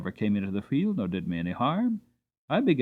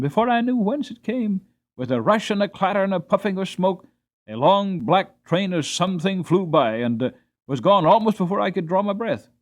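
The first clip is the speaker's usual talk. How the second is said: higher and louder, rushing breathless telling; excited narration; 8 semitones higher, 5 dB louder than usual. A narrator's reading. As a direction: tell it as if the train is thundering past right now. Train rushes past, horse startled.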